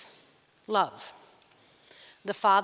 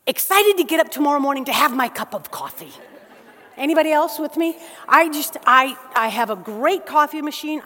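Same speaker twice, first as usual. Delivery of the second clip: louder, higher, fast gushing about coffee; excited about coffee; 4 semitones higher, 7 dB louder than usual. Real, not acted. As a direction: bubble over with real delight in your coffee. Pastor gushing about his coffee.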